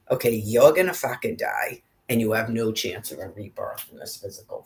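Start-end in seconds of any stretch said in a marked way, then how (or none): none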